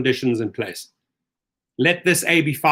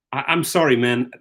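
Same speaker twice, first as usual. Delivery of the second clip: ordinary talk, nothing marked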